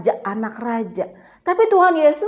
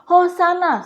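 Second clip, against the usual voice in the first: crowd cry in a higher voice; mild mock shout; 5 semitones higher, slower than usual. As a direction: imitate a cheering crowd lightly.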